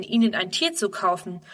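ordinary delivery, nothing marked

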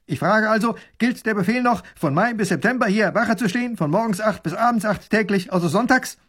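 high-pitched, emphatic military query; dutiful soldier. Acted. Soldier asking the Grand Duke about orders, dutiful. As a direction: recite the orders eagerly.

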